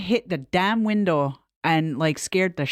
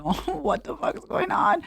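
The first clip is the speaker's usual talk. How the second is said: high, drawn-out mock dread; slow, raised-pitch alarmed question; retold dread; 9 semitones higher than usual. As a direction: replay that sinking-stomach moment. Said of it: "my stomach sunk".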